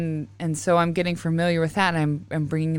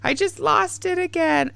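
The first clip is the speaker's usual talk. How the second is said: much higher, mock flustered voice; flustered self; 3 dB louder than usual. Host mocking her own flustered moment, high and flustered. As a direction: play your own panic at losing the thread. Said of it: "You could tell at the second I was like".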